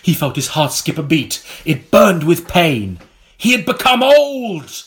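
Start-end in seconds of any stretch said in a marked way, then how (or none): 3.4-4.8 dramatic. A narrator's reading. Dramatic reveal in story.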